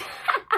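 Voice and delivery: short, loud wicked queen's cackle; evil cackle. Acted. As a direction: cackle with delight. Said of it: "She laughed".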